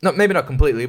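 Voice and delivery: usual speaking voice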